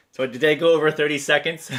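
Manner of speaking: amused question through a laugh. Real, not acted. Laughing at a chat comment.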